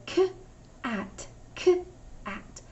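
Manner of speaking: k and at said apart; chunked sounds. Shown as a demonstration. Teacher shows how she hears the chunks. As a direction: segment the sounds with pauses. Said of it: "when I'm chunking it".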